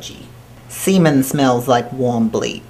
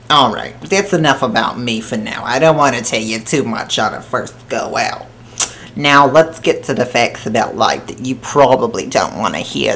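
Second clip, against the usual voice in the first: thick put-on New Jersey accent, held all the way; 3 dB louder than usual. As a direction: talk like a crude New Jersey broad throughout. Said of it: "I don't know where the accent came from".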